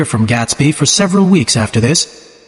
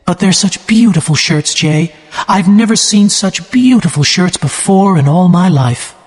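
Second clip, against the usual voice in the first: crying; 6 semitones higher than usual. A narrator's reading. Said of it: "began to cry".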